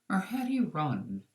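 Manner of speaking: ordinary delivery, nothing marked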